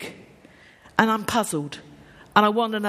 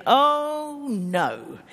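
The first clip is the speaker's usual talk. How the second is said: long drawn-out, higher "oh no"; mock dread; 5 semitones higher than usual. Preacher voicing congregation's thought about the sermon, mock dread. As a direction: imitate a listener's dismayed groan. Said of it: "you're sitting there thinking".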